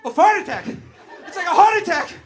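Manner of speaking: loud, high, cracking up; laughing shout. Retold or imitated. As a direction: shout it while losing it laughing. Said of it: "I was like".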